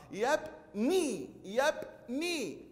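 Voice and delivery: يبني very slowly, split into yab and a long nii; saturated long nii. Shown as a demonstration. Teacher drilling the noon for pupils, split syllables. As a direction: pause after yab in يبني, then stretch nii. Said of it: "نشبع النون بالكسرة".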